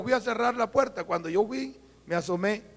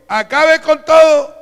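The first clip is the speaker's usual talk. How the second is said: slow, loud divine order; booming command; 6 semitones higher, 13 dB louder, slower than usual. Retold or imitated. God ordering the king to destroy everything, slow booming command. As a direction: thunder it out slowly like God commanding.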